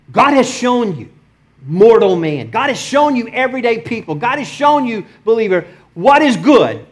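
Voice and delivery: high, ringing scripture proclamation; preaching at full force. Acted. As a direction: declare it emphatically to every believer.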